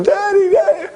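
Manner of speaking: put-on high, squeaky little kid calling with a laugh; mock a small child running to his daddy; hammed-up child's cry of Daddy. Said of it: "like a little kid running".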